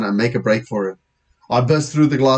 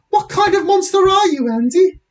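put-on very high, scandalised girl; horrified Lisa; 3 dB louder than usual. Girl to boy caught with mother's bag, high and horrified. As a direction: wail the accusation high-pitched.

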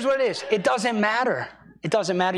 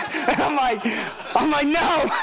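laughing disbelief; 11 semitones higher than usual. Real, not acted. Pastor laughing while retelling the wrong-number call.

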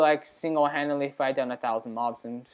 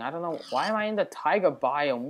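usual speaking voice

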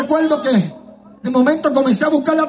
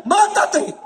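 higher-pitched devil's command, lightly acted; devil's urging; 4 semitones higher than usual. Devil to the singer alone in his room, urging. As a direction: urge it as the tempting devil. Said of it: "la maquinación del diablo a decirme".

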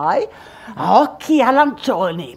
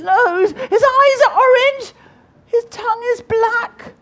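mock terror; 9 semitones higher than usual. Mouse spotting the monster, hammed-up panic. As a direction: pile on the terror, then cry for help.

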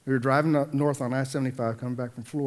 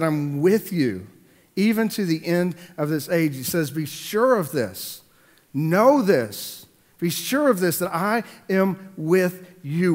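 slow, weighty, louder; preaching quote; 5 semitones higher, 5 dB louder, slower than usual. Acted. Pastor voicing God's promise to the congregation, slow and weighty. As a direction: proclaim it as a promise.

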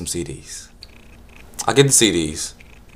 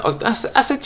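ordinary talk

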